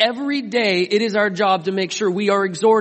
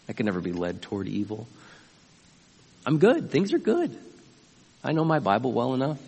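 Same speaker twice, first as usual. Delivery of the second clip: smug self-assurance; 6 semitones lower, 5 dB quieter, faster than usual. Retold or imitated. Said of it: "You've heard people speak like this".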